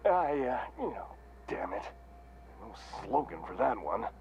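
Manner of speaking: halting, pleading admission; flustered, pained. Acted. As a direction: stumble as the cheerful act cracks. Mascot's act cracks when confronted.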